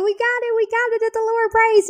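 high and fast, thrilled; excited yelling. Retold or imitated. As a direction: yell the good news down the phone. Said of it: "started yelling".

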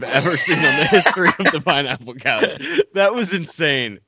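laughing through the words. Real, not acted. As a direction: talk while cracking up. Host laughing at ice chewing.

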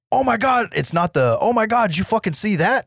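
high-pitched, hammed-up astonishment; mock shocked reaction. Co-host imitating a shocked moviegoer, high mock amazement. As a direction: exclaim it like a viewer reeling at a gory kill.

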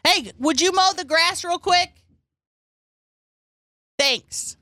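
high, carrying call. Pretend. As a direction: call across the yard. Calling to man outside.

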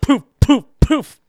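gunshot sounds. Pretend. Mimicking gunshots.

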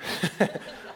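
quick airy giggle; breathy chuckle; light laugh. Real, not acted. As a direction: laugh off the heavy moment. Laughing off a sad ending.

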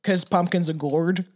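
slow, drawn-out campfire-tale read; lightly hammed spooky storyteller; spooky story narration. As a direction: tell it like a ghost story, slowly.

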